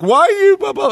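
high whiny mock of offended people; mock offended whine. Teller mocking people offended by honesty, high whine. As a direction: whine it like they're hurt.

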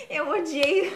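laughing, drawn out and high. Real, not acted. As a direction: laugh through the words. Laughs while agreeing. (rindo, arrastado e agudo)

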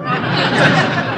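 short audience laugh; quick crowd chuckle; audience laughter. Real, not acted. Studio audience chuckling at the resolution.

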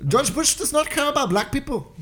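put-on high Indian accent rapper line; Gandhi West impression. Friend showing his Gandhi West impression in Indian accent. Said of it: "my impression? It's called Gandhi West".

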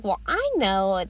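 slow, drawn-out know-it-all mimicry; smug mock. Mocking people who act sure about everything, smug slow mimicry.

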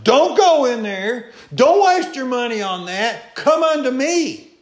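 high, loud, urgent warning at the door; crying out a warning. Acted. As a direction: call them back before they step inside. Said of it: "she's crying out to them".

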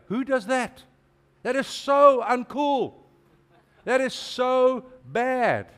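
high, loud, drawn-out scoffing; playful imitation of snobby onlookers; mock disapproval. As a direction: sneer like the cool crowd mocking the pier worship. Mocking what people say.

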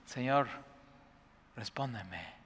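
soft, hushed plea, fading at the end; just ask simply, no show; quiet simple prayer. Shown as a demonstration. Pastor contrasting the noisy show with a quiet prayer. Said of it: "Todo un rollo, bailes, gritos, nada".

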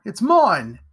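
insistent claim. A narrator's reading. Boy demands his knife back.

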